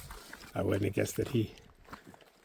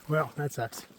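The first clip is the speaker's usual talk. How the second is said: normal talking voice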